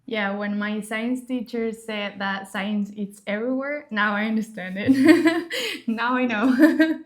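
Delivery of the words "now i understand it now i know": laughing. Real, not acted. Laughing at her own joke.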